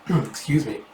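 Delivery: plain reading voice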